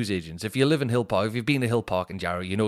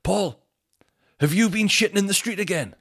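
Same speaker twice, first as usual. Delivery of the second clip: mock angry telling-off; 10 semitones higher, 4 dB louder than usual. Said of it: "He just went".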